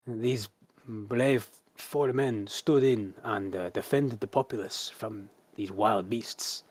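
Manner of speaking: very slow, deliberate soldier's report; slow formal report. Acted. Captain reporting to the Arch Zealot, slow and formal. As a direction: lay it out slowly and dutifully.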